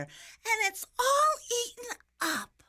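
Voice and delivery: put-on slow, high, loud baby bear wail; tiny bear's pouty, tearful whine; pouting baby bear. A narrator's reading. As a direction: wail it very high, drawing out the words. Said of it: "pouted the little small baby bear".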